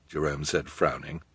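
ordinary delivery, nothing marked

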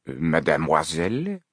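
slow, drawn-out French greeting, rising at the end; French-accented, lingering and courteous. A narrator's reading. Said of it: "The manager spoke with a French accent".

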